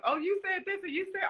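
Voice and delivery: high, eager aunt voice; aunt recounting excitedly; high-pitched aunt impression; imitating aunt. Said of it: "my aunt was like".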